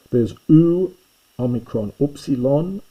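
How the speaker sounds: u said high and loud as in tool; sounding out ou. Shown as a demonstration. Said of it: "pronounced as in tool".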